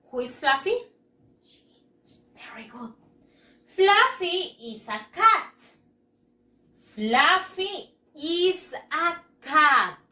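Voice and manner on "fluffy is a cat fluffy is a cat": put-on slow, clear reading for children to follow; slow model reading. Shown as a demonstration. Teacher reading slowly aloud to young pupils. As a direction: read each word slowly for the class. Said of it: "repitan después de mí".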